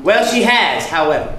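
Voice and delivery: high, loud insistence on the first words; loud retort. Acted. Husband contradicting his wife about her sister, loud insistence. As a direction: override the denial loudly.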